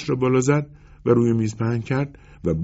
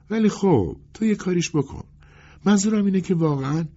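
high, faltering plea; helpless, halting customer; pleading customer; 11 semitones higher than usual. Acted. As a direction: beg for any fix, pitched high.

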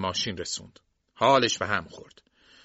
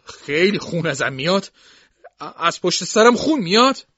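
high, loud, frightened questioning; scared, innocent child; 6 semitones higher, 8 dB louder than usual. A narrator's reading. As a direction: ask like a helpless frightened kid, pitch climbing.

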